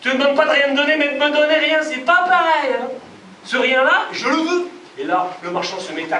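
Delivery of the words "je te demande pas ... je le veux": louder, pressing demand; insistent. Acted. Hiroshi pressing the merchant for his nothing, loud. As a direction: push the point home hard.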